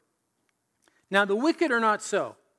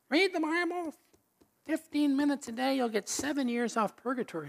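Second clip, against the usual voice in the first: put-on higher, lilting old woman's voice; grandma's voice pitched well up; high, singsong grandma impression; 8 semitones higher than usual. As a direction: mimic grandmother sweetly urging. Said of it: "She says".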